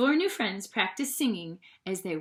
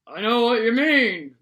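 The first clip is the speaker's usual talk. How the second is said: a hint of a farmer; 7 dB louder than usual. A narrator's reading. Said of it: "the one farmer said".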